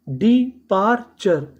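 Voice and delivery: Departure drawn out slowly, every syllable clear; slow teacher model. Shown as a demonstration. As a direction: spell out departure slowly for learners. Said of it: "departure का मतलब".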